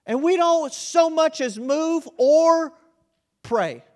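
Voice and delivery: high, loud, slow build; emphatic preaching. Real, not acted. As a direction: drive it home from the pulpit. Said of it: "preaching climax".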